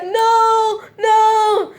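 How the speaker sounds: high, sing-song crying no; hammed-up sobbing protest; imitating a wailing woman; mock wail. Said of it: "And she goes like".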